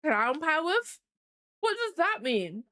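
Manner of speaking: talking around a mouthful of ice. Real, not acted. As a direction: mouth full of ice, words muffled. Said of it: "Sorry, I got ice in my mouth".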